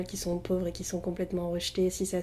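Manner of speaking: ordinary talk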